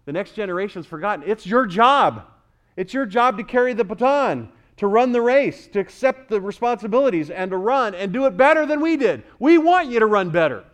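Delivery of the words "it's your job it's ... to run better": raised, emphatic sermon charge; impassioned preaching. Real, not acted. Preacher urging the next generation to run, impassioned. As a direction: preach it high and forcefully.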